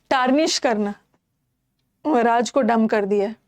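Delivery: usual speaking voice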